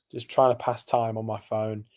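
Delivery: ordinary delivery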